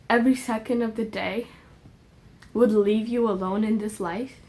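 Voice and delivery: low, halting, choked-up plea; long pauses as real emotion takes over; getting emotional. Real, not acted. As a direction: struggle to get each phrase out. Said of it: "I'm getting emotional".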